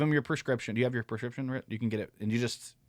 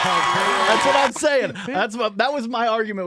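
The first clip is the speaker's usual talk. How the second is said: high, slow, emphatic agreement; excited; 11 semitones higher, 10 dB louder, slower than usual. Real, not acted. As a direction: drag it out, high and loud.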